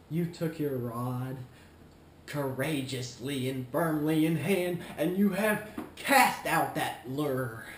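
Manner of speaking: loud, slow mock-heroic speech; grandiose declaiming. Grandiose fishing analogy, declaimed.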